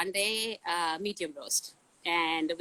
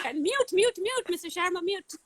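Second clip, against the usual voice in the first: high teasing sing-song chant, laughing at herself; playful high mock chant of mute; mock students muting her; 11 semitones higher than usual. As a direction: pitch it up like kids jeering. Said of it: "And then you guys will".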